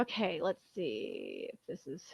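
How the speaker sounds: slow, low, soft muttering; muttering to self. Real, not acted. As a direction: mumble while you search. Muttering to self while searching.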